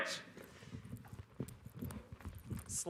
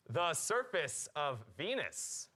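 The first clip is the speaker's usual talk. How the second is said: usual speaking voice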